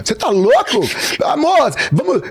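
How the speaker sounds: high, drawn-out mock female pleading; protesting girlfriend. Girlfriend begging him to still come along, whiny.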